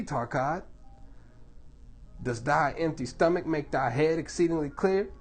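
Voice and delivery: ordinary delivery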